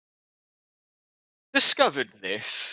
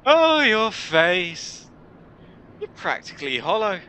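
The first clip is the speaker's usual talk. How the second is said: mocking dismay; 9 semitones higher, 5 dB louder than usual. Warrior sees the newcomer's hollow face, mocking dismay. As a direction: stretch it high in mock horror.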